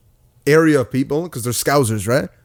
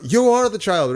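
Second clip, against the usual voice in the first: mock host announcing a verdict, pitched up; talk-show host; 7 semitones higher than usual. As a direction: imitate a TV host's big reveal.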